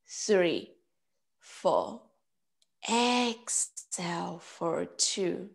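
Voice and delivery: slow, measured meditation voice with long pauses; guided breathing. Real, not acted. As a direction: count them calmly through each breath, unhurried. Leads breath counting and meditation.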